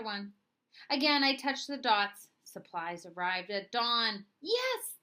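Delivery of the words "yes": much higher, triumphant yes; excited cry. Acted. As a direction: burst out in delight. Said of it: "the headmaster cried".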